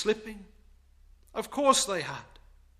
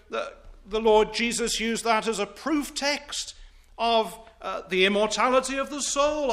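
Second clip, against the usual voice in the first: raised, louder pulpit preaching; 7 semitones higher, 4 dB louder than usual. Acted. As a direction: drive the point home from the pulpit. Preacher rising to full force.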